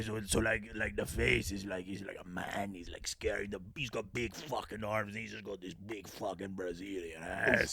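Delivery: hushed. Acted. Describing monster, lowered voice.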